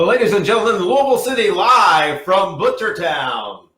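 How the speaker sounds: big, booming ring announcer call; wrestling announcer voice. Shown as a demonstration. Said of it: "can you do this in a wrestling announcer voice".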